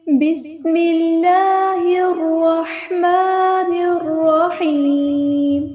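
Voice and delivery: slow, drawn-out chanted prayer, rising for the verse opening; melodic recitation. Acted. Teacher opens the lesson with slow chanted prayer. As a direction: intone each phrase slowly like a qari.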